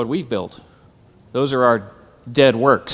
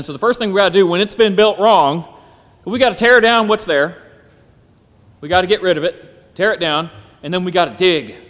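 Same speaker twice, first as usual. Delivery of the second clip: preaching; 3 semitones higher, 5 dB louder than usual. Acted. Preaching at full force.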